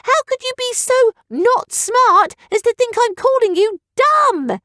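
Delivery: high silly villager voice; silly heckler. Acted. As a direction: squeak out offended protest. Offended heckler voice.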